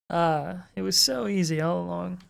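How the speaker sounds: ordinary delivery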